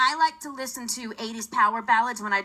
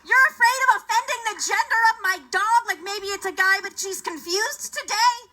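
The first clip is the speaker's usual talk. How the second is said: exasperated sarcasm; 8 semitones higher, 4 dB louder than usual. Retold or imitated. Comic retelling exasperated at the pet store clerk. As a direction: spell out the obvious to the clerk. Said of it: "I'm like".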